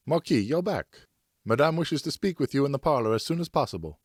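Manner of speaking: a housemaid gravely hurrying her master; urgent maid. Acted. Nonaire the maid speaks.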